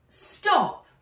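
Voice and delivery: loud, high cut-off, interrupting; girl snapping to interrupt a friend, loudly; sharp interruption. Acted.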